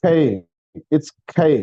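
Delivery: खे with a strong breathy kh burst; aspirated kh. Shown as a demonstration. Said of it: "के की आवाज खा की साउंड देगी". (खे में ख की हवा)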